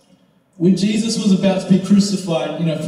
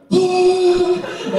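long high-pitched jeering "ah"; mocking hoot; 13 semitones higher than usual. Onlooker points at the fallen student, high mocking hoot.

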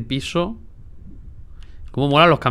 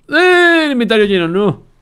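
loud high indignant exclamation; startled outburst; 11 semitones higher, 9 dB louder than usual. Real, not acted. Gamer with a full inventory, loud startled protest. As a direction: yelp in frustration.

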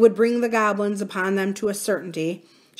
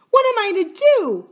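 high, loud cry of despair; distraught nurse; 14 semitones higher, 8 dB louder than usual. A narrator's reading. Nurse who cannot get princess home, distraught cry. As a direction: wail it high and helpless.

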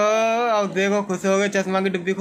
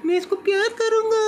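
very high cooing voice. Real, not acted. Uncle about to cuddle his nephew, high doting baby talk. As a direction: coo it way up high to a little one.

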